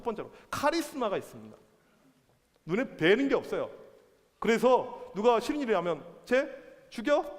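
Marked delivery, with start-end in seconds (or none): 6.2-7.2 lightly acted queen, voice lifting on the threat; gleefully murderous queen. Queen to anyone she dislikes, lightly acted smiling menace. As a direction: toss out a death threat with amusement. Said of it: "웃으면서".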